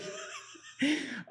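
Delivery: a high, real burst of laughter. Real, not acted. Laughing at being fired right after praying, high laugh.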